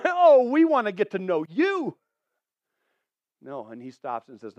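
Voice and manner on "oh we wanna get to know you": loud, high, gushing fans; eager crowd. Acted. Crowd coming to meet John, eagerly gushing. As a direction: crowd fawning over a celebrity.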